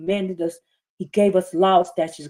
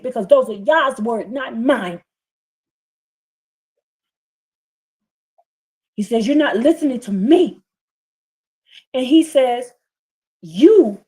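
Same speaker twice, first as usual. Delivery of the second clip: preaching at full force; 5 semitones higher, 6 dB louder than usual. Acted. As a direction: thunder the scripture over the congregation.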